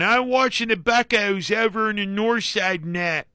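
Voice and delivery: high, exaggerated Pittsburgh accent; heavy Pittsburghese. Host imitating Pittsburgh locals in a heavy Pittsburgh accent. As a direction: ham up a Yinzer in a Pittsburgh accent. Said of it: "The heavy Pittsburghese".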